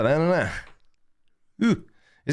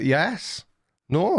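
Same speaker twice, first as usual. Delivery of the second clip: ordinary talk, nothing marked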